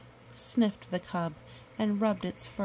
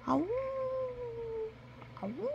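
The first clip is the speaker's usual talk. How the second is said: howl; 5 dB quieter than usual. Acted. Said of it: "answered by the cry of another wolf".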